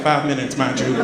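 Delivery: usual speaking voice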